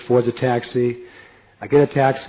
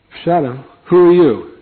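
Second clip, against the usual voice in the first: louder, higher startled challenge; surprised devotee; 6 semitones higher, 8 dB louder than usual. Retold or imitated. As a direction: demand who the stranger is.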